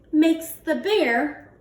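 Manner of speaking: plain reading voice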